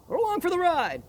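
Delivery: high, bright excited voice. Real, not acted. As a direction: say it like a thrill ride. Excited aside.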